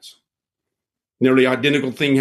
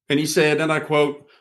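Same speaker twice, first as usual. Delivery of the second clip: ordinary talk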